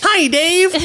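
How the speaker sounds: very high, squeaky chipmunk voice. Pretend. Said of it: "I miss the chipmunk voice so much".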